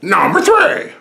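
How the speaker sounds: high, full shout; countdown announcer. Pretend. Host announcing his third pick, shouted announcer call. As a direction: shout it like a big reveal.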